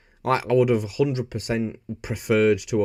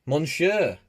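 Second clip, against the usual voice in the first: raised, hammed-up French monsieur; a touch of French flair; mock French; 6 semitones higher than usual. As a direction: lilt it like a cartoon Frenchman.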